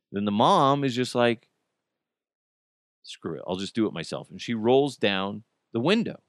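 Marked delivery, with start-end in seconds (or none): 3.0-4.3 low, very soft, fed-up mom; quietly exasperated, acting out the mom; resigned aside. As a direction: say it under your breath, giving up. Fed-up mom gives up, retold.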